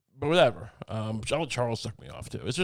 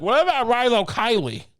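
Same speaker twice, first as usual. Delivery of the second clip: mocking co-host's suggestion; 8 semitones higher, 7 dB louder than usual. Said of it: "and you're like".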